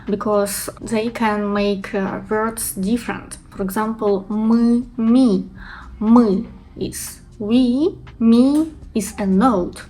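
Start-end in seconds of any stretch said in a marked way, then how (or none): 4.3-5.5 мы with hard ы, ми with bright и; ы versus и contrast. Shown as a demonstration. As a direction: show the vowel difference. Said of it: "distinguish E and I sounds".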